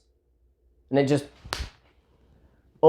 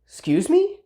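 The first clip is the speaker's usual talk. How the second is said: shocked disbelief; 11 semitones higher than usual. Retold or imitated. Reacts to slap explanation.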